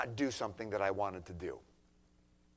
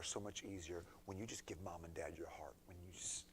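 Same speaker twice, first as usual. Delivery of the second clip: hushed; 5 semitones lower, 15 dB quieter than usual. Real, not acted.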